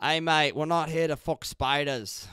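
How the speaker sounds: loud, high-pitched, drawn-out Aussie call; Australian accent. Acted. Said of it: "That's what Australian people will say".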